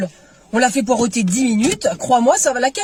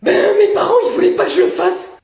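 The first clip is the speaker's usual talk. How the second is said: mocking high voice; 8 semitones higher, 6 dB louder than usual. Mocking voice of parents.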